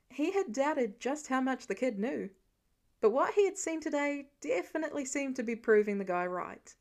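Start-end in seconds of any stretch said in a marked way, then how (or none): none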